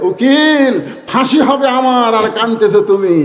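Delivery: ordinary talk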